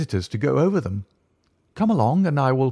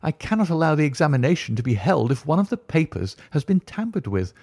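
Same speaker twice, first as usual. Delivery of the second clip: much higher, pleading, alarmed tutor; astonished dismay; 7 semitones higher than usual. Acted. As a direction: cry out in dismay at being left alone. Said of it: "cried aloud in his astonishment and dismay".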